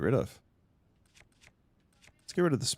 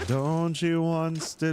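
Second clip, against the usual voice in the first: slow sing-song tease, a bit quicker; slow teasing; 5 semitones higher, much slower than usual. Pretend. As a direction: tease it out slowly. Repeated teasing question.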